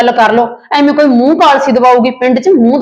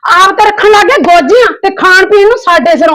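tearful outrage; 8 semitones higher than usual. Acted. Aunt arguing with the nephew, outraged and tearful. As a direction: flare up with a whimper of grievance.

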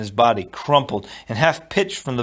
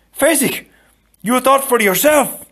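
high, loud exclamation of amazement; terribly excited; 13 semitones higher, 6 dB louder than usual. A narrator's reading. Inigo praises Fezzik for thinking, terribly excited. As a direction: burst out with astonished praise. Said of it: "Inigo was terribly excited".

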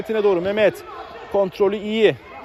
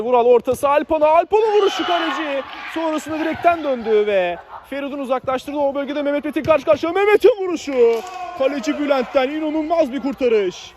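excited commentary; 9 semitones higher than usual. Real, not acted. Chance in live commentary.

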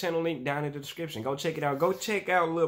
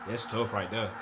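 ordinary delivery

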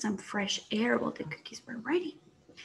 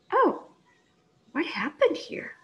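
high startled "oh"; surprised; 6 semitones higher than usual. Acted. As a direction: caught off guard by something odd. Finds cookies moved.

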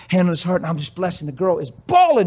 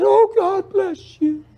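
much higher, slow tearful blessing; overwhelmed woman thanking the driver, tearful; teary thanks; 12 semitones higher, much slower than usual. Retold or imitated. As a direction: choke it out through tears. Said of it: "burst out in tears".

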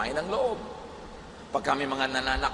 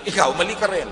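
louder, sharper comeback; mock retort; 5 semitones higher, 6 dB louder, faster than usual. The listener firing back at an accuser, louder and sharper. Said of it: "pag sinabi mo".

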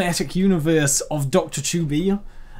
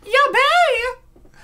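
high, loud anime-style panic; panicked exclamation; 8 dB louder than usual. Acted. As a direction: squeal it like a flustered anime girl. Assistant panics in Japanese.

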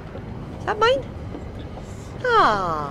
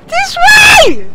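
much higher, louder yell; full shout; 10 dB louder than usual. Real, not acted. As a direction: bellow it the second time. Repeats it louder.

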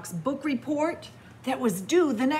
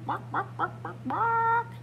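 high, playful dog barking; high mock barks; 10 semitones higher than usual. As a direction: bark like a little dog.

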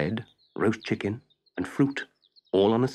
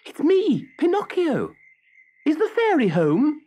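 put-on high, loud boy's call; puppet calling up; 5 dB louder than usual. A narrator's reading. Pinocchio calls up to the snail at the window, high and loud.

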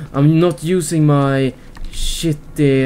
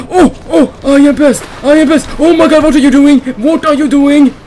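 loud, much higher and fast; frantic shouting; 9 semitones higher, 8 dB louder than usual. Real, not acted. Gamer in a frantic chase, loud fast high shouting. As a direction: rattle it off in panic.

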